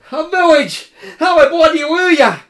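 high, loud joyful cry; cried out in joy. Acted. Koma crying out at the lights, high and loud. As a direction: shout with relief at the light. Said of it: "cried Koma".

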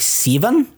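"Seven?" said with a New Zealand vowel, like "sivven", rising high; New Zealand accent. Retold or imitated. Mimicking how New Zealanders say "Seven?", with a Kiwi "sivven". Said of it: "And that one's like".

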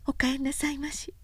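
put-on a shy young woman welcoming him home; young woman's greeting. A narrator's reading. Oyuki greeting Sōshichi at home, gentle and high.

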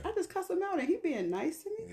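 acted-out bewilderment at unexpected kindness; mock confusion. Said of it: "That will confuse the hell out of me".

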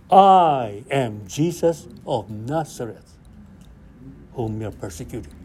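voice from heaven. Retold or imitated. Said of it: "Now the voice coming from heaven".

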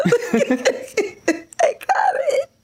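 high, slow, giggling words; can barely get it out for laughing; laughing through it. Real, not acted. Laughing while retelling own reply.

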